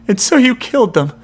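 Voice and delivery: high, loud, stunned cry; cry of disbelief. A narrator's reading. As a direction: cry out unable to believe it. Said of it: "Jason cries out in disbelief".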